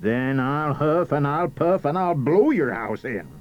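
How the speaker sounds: big bad wolf, building to the blow; threatening wolf. A narrator's reading. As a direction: raise the voice into a storybook wolf's threat. Wolf threatens second pig.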